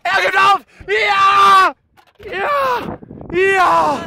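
high, loud cheering of triumph; victory shouts. Real, not acted. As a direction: yell out the win with joy. Wins the sauna car challenge.

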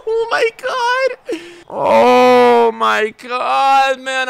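high, very slow drawn-out cry; anguished wail. Real, not acted. Gamer to his viewers after losing a goal, anguished slow wail. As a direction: wail it out in pain. Despair, crying sound.

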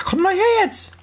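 high, sharp call to lure him; high-pitched taunting call. Real, not acted. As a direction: yell at the enemy to come. Calling enemy over.

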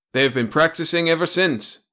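ordinary delivery

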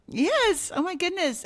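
lighting up as it comes back; high, loud excited "oh my goodness"; sudden excitement. Real, not acted. Suddenly remembering another story.

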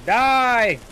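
loud, high-pitched yell; battle shout. Real, not acted. Gamer attacking an enemy, loud high shout.

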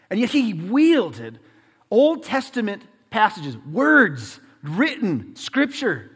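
slow, loud, word-by-word preaching; weighty sermon emphasis, drawn out; emphatic preaching. Real, not acted. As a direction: stretch and punch each key word.

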